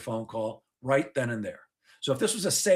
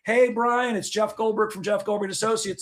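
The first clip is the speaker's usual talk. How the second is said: chirpy voicemail; 7 semitones higher than usual. Retold or imitated. A salesman leaving chasing voicemails for Brian, mock chirpy and high. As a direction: play the hopeful rep leaving cheery voicemails. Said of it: "we leave messages like this".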